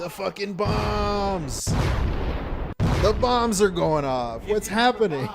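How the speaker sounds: loud slow shout. Real, not acted. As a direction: yell it out in shock. Gift bomb goes off on stream.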